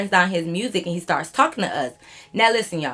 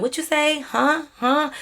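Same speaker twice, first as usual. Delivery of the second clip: high-pitched, puzzled questions; retold confusion, pitched up; puzzled asking; 8 semitones higher, slower than usual. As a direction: ask as if you can't understand him. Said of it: "we had to keep saying".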